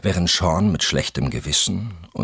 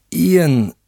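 high, loud desperate cry of a name; 14 semitones higher, 8 dB louder than usual. A narrator's reading. Wife begs her furious husband, desperate cry. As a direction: plead his name in alarm. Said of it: "sagte Valerie verzweifelt".

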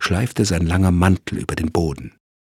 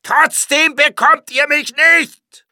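much higher, loud, slow furious scream; gassed murderer screams defiance at the police; frenzied rage; 6 dB louder, slower than usual. A narrator's reading. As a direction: scream it out half mad with rage. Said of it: "Das Gas trieb ihn zur Raserei".

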